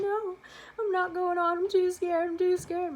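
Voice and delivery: put-on high, loud, panicked child's plea; cling to the fence and beg; terrified kid refusing the ride; scared little girl. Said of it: "and I'm like".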